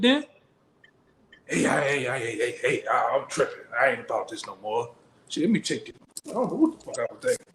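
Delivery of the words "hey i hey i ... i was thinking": mumbled low and soft, backing out; scared quitter. Acted. Preacher acts out a follower backing out, soft mumble. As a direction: play someone losing their nerve.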